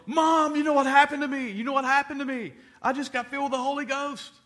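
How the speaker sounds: high, loud, bursting with joy; excited shout. Retold or imitated. Son tells his mom he got the Holy Ghost, overjoyed and loud. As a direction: shout it out like you can't hold it in.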